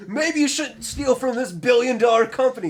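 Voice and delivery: mock corporate shill. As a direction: gush over the company sarcastically. Mocking corporate loyalist.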